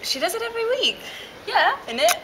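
high put-on voice. Teasing friend, put-on voice.

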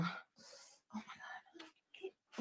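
soft whispered oh my God. Real, not acted. Baby finally falling asleep.

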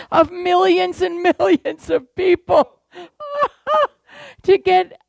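real laugh breaking into the words; giggling over millions of viewers. Real, not acted. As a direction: laugh through it, amused at the absurdity. Laughs at TV audience idea.

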